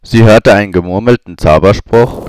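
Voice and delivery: usual speaking voice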